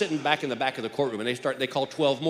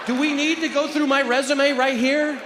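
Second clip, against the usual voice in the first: indignant; 12 semitones higher, 7 dB louder, slower than usual. Retold or imitated. Indignant juror to judge.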